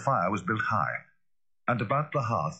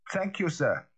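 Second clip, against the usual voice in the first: triumphant; 11 semitones higher, slower than usual. A narrator's reading. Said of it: "a note of something like triumph".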